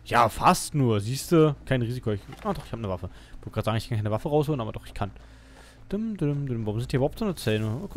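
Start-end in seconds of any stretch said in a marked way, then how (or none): none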